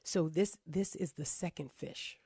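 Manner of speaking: soft hushed confiding voice; whispering. Real, not acted. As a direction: keep it low, like a secret. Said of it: "I don't know why I have to whisper this".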